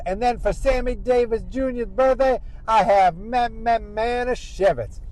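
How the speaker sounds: hammed-up drunk woman making a speech; mock drunken toast. Drunk Naomi's toast before passing out.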